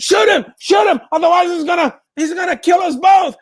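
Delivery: high, loud order to shoot; frantic shout. Acted. Driver screams at the guard to shoot. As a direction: scream at him to fire.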